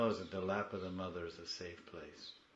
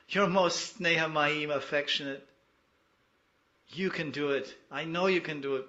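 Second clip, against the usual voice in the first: loud, high pleading cry to the mother; fervent prayer; 6 semitones higher, 9 dB louder than usual. Acted. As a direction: beg her with your whole heart, higher and louder.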